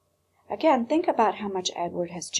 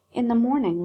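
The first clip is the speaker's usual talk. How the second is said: ordinary delivery, nothing marked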